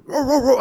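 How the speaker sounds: loud, high dog barks; barking like a dog. Retold or imitated. Barber re-enacting sneaking up on his coworker, loud dog barking. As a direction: bark sharply to make someone jump. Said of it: "I start barking like a dog".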